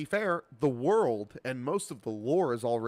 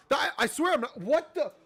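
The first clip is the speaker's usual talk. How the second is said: flustered confusion; 7 dB louder than usual. Real, not acted. Game glitch, flustered denial.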